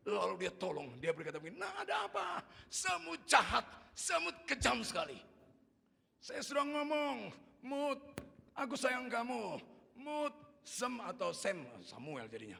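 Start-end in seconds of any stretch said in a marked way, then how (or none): 1.6-2.4 alarmed father. Retold or imitated. Papa rushes to screaming child. 2.7-11.6 put-on high, loud aggrieved child; loud, drawn-out child's complaint; child complaining. Bitten child complains to papa.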